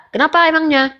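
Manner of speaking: ordinary talk, nothing marked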